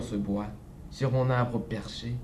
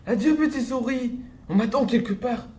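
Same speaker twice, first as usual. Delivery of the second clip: panicked cry; 10 semitones higher than usual. Acted. The fox cries for help on seeing the gruffalo, very high. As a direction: shriek and flee. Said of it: "il cria".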